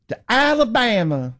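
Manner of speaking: To Alabama drawled high, mock Southern; mock Forrest Gump drawl. Host parodying AOC's accent, sounding like Forrest Gump, mock Southern. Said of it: "She sounds like Forrest Gump".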